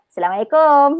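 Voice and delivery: Assalamualaikum run together, ending in a long rising "-kom"; wrong Malay pronunciation. Shown as a demonstration. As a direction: slur the ain away in Assalamualaikum, stretch "kom". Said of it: "jangan sapa dia".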